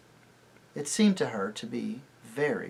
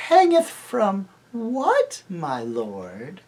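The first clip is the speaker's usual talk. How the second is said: mocking; 8 semitones higher, 7 dB louder, much slower than usual. A narrator's reading. Witch mocks the prince.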